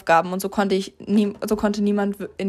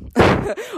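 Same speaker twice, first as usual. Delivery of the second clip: short giggle; 12 semitones higher than usual. Real, not acted. Giggles after mentioning friend.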